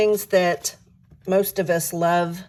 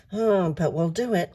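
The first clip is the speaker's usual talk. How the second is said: ordinary talk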